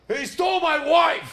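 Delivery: loud, high movie-hero outcry; Harrison Ford. Retold or imitated. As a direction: shout it like a wronged action hero. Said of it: "like, Harrison Ford, the movie".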